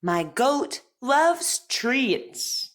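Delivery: slow, careful speech-therapy reading; crisp model sentences for repeating; over enunciating. Shown as a demonstration. Therapist reads practice sentences.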